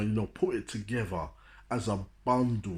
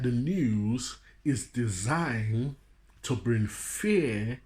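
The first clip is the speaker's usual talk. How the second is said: slow emphasis; much slower than usual. Real, not acted. Slow emphatic point in preaching.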